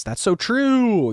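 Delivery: high, slow, excited "so true"; excited agreement. Real, not acted. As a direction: gush in agreement, drawn out. Agreeing with chat comment.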